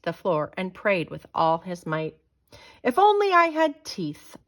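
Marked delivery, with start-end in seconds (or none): 2.8-4.4 high, longing inner voice; wistful wish. A narrator's reading. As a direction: wish it sadly. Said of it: "thought George".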